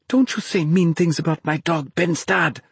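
put-on high, indignant girl's retort; indignant scolding. A narrator's reading. Naomi to Ben after he insults her dog, indignant. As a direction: scold him in defence of your dog.

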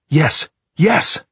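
loud, high, climbing cries; desperate shouted agreement. A narrator's reading. Locked-in man agrees to anything, shouting desperately.

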